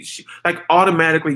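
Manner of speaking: ordinary talk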